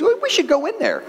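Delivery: high, quick chicken suggestion; eager chicken. Acted. Chicken urging pig to go into church, eager. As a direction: pitch it up, quick and keen. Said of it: "the chicken looks at the little pig and goes".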